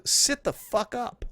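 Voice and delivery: slow, exaggerated telling-off; exasperated scolding. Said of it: "My first thought was".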